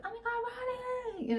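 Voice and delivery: put-on high, squealing kid excitement; imitating the girls' thrilled welcome home; mock-gushing kids shouting we're home; excited little girls. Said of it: "they're all excited we're home".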